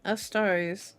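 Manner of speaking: plain reading voice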